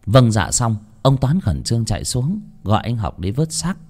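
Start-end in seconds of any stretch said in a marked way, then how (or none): none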